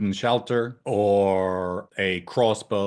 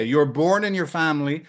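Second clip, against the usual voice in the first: usual speaking voice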